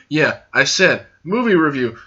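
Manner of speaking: firm answer with a falling end; matter-of-fact confirmation. Acted. Host answering the imagined viewer, matter-of-fact confirmation. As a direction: confirm it plainly, as if repeating. Answers own mock question.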